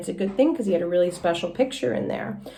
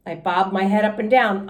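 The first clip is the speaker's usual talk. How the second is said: ordinary delivery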